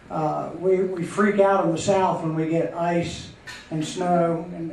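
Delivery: normal talking voice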